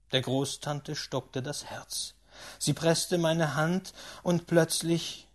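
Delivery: usual speaking voice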